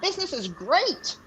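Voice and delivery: high-pitched chatty goblin; high goblin voice, rattling on about business; goblin merchant. Acted. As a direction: talk shop as a little goblin trader.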